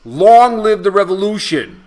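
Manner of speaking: shouted rallying cry; fiery revolutionary speech. Acted. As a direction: bellow it like leading a crowd. Fiery show opener cry.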